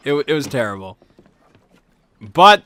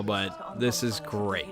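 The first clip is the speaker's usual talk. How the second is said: sarcastic mutter; 6 semitones lower, 12 dB quieter, slower than usual. Retold or imitated. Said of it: "sarcastic aside".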